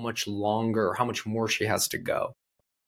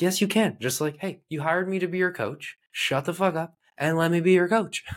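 higher, emphatic tough-love coaching; firm coach retort; 7 semitones higher than usual. Retold or imitated. Coach overruling his client's doubts, forceful and higher. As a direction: push back hard on a doubting client.

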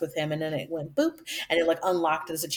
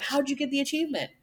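eager question; 8 semitones higher, faster than usual. Retold or imitated. Retold eager question to friend.

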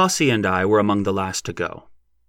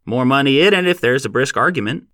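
louder, higher and sharp; 5 semitones higher, 6 dB louder than usual. A narrator's reading. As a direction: bark it out briskly. Sharp old man replying.

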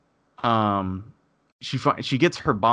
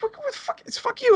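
squeaky-high outraged cursing; retold raised voice. Cursing at saleswoman after divorce remark, high outrage. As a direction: reenact outrage at the top of your pitch. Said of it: "I pretty much raised my voice at her".

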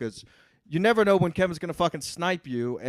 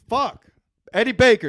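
excited outburst; 9 semitones higher, 12 dB louder than usual. Real, not acted. Sudden remembering, loud.

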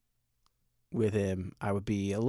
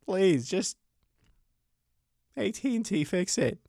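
desperate plea; 9 semitones higher than usual. Retold or imitated. Pleading with AT&T on phone.

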